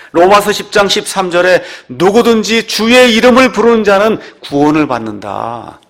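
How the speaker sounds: declaimed scripture. Real, not acted. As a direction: proclaim the verse to the whole church. Declaims a Bible verse.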